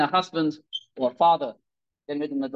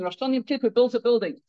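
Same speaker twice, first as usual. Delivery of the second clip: normal talking voice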